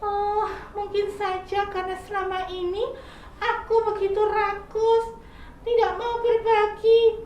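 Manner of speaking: sick caterpillar. Acted. Caterpillar telling squirrel his stomach hurts, high whiny voice. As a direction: answer like a sorry sick child.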